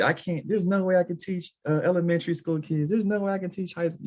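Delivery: high whiny complainer voice; mock whiny complainer. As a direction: whine it like a reluctant teacher. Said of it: "you have people say".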